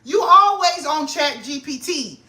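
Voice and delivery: high, hyped self-talk; excited self-talk. Retold or imitated. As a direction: talk yourself up, amazed. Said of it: "And I'm like".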